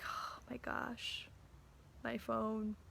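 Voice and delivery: whispered, slow mourning of the phone; hushed lament for the lost phone; sad whisper. Retold or imitated. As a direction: breathe it out like a small loss. Felt pretty sad about phone.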